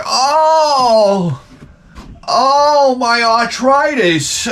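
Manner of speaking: long high mock wail; moaning wife. Wife complains of arthritis in the store, high hammed-up wailing. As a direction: draw out each moan, hammed-up and high.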